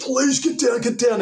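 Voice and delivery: high, loud command to get down; shouting orders. Retold or imitated. Undercover cop yelling at dealers to drop down, high and loud. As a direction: bark it out, high and urgent. Pulling revolver during the bust.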